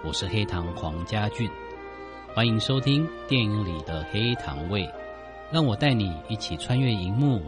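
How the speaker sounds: smooth announcer read over music; radio host intro. Acted.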